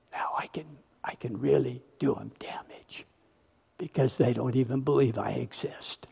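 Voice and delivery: hushed, gloating devil voice dropping to a whisper; a quiet, sly Satan plotting in the dark; sinister Satan whisper. Acted. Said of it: "And Satan goes".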